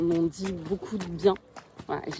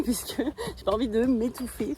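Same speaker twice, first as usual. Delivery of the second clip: ordinary talk, nothing marked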